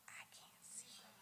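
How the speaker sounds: breathy voiceless whisper. Retold or imitated. Quoting someone in the crowd, in a whisper. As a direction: whisper it like a quiet aside. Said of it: "Oh, I say".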